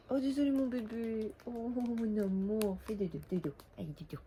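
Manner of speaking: soft soothing, fading to nothing; tender lullaby. Real, not acted. Soothes dog back to sleep.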